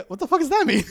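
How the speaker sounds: high, loud mock disbelief; imitating a friend's incredulous reaction; baffled outburst. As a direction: exclaim it in amused bewilderment.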